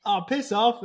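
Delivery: high, loud cheeky brush-off; dismissive retort. Retold or imitated. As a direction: wave him away with a laugh. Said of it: "looked at him and went".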